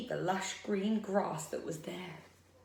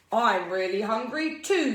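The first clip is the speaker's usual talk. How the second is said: lightly voiced troll snarl, louder; 3 semitones higher, 7 dB louder than usual. A narrator's reading. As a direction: snarl it loudly as a storybook troll. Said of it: "snarled the troll".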